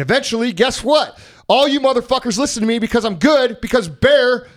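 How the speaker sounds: high, emphatic proclamation; excited boasting. Shown as a demonstration. Host tells listeners why he's good now, excited. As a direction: punch it out like a hype speaker. Shows off the learned loud enthusiasm.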